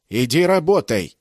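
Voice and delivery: slow, loud barked command; angry order. Acted. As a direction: drive the slave back to work. Guard orders prisoner to work.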